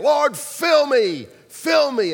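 loud, slow shouted plea; loud drawn-out worship shout; fervent repeated cry. Retold or imitated. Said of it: "He kept saying it".

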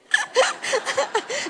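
high burst of amused laughter. Real, not acted. Laughing over girls' Southern-accented Hindi, amused laugh. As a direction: laugh at your own story. Laughs at her own joke.

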